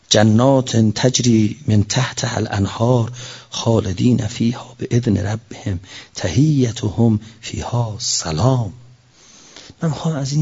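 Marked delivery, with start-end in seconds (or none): 0.1-8.7 slow, low intoned verse; Quran recitation. Acted. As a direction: chant the verse reverently, slowly.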